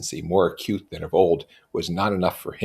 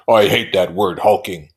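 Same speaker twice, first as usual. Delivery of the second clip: cross; 2 semitones higher, 9 dB louder than usual. A narrator's reading. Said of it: "objected Tom crossly".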